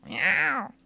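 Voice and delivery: high-pitched mock cat meow; a drawn-out catty "meow". Catty jab after retold spat.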